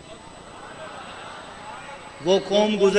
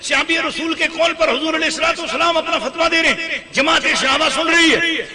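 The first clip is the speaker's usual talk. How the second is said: high, driving sermon delivery; full-force preaching; 10 semitones higher, 6 dB louder than usual. Real, not acted. Preacher explaining the Prophet's ruling to the crowd, full-force preaching. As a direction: build authority phrase upon phrase.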